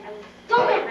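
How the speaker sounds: ordinary delivery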